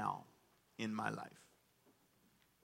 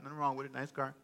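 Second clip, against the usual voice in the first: ordinary talk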